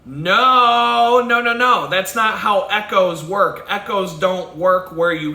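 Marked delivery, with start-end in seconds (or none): none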